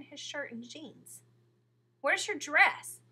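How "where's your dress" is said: blurted out high, loud and slow; a startled boy blurts it out; sudden loud blurted question. A narrator's reading. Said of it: "he blurted".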